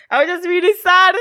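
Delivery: laughing through the words. Real, not acted. Giggling as she admits to a friend how sad she was. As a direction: let the laugh break into sad.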